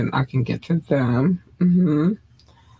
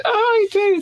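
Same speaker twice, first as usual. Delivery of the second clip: sudden squeaky falsetto, much higher; gushing squeal. Real, not acted. Gushing at fans in shoutouts.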